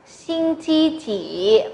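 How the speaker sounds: "Sinh chi chỉ" very slow, ending on a rise; slow teacher demo of "Sinh chi chỉ"; slow model reading. Shown as a demonstration. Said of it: "Nào, là thứ mấy?".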